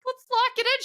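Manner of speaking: high, strained squeak; mock pained composure. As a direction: imitate squeezing words out while losing it. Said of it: "pain on my face".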